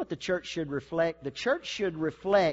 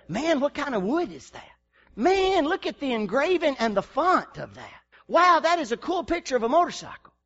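higher, impressed gush over the woodwork; mock gushing admirer; 8 semitones higher, 5 dB louder than usual. Preacher mimicking a shallow admirer, impressed. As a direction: play a dazzled onlooker missing the point.

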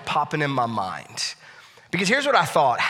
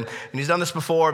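ordinary talk, nothing marked

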